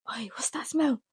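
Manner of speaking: high whispered mom voice; a sniffing, suspicious mother in a hush; mock mom hissing at smell; imitating mom whispering. Mom voiced in retold scene.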